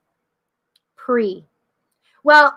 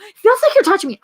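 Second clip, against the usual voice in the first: high, amazed insistence; acted-out astonished protest, pitched up; insistent partygoer; 7 semitones higher, 3 dB louder, faster than usual. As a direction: imitate someone baffled and protesting.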